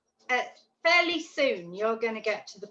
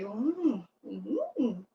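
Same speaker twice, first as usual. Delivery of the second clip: excited ooh; 5 dB quieter, much slower than usual. Shown as a demonstration. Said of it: "And you rustle it".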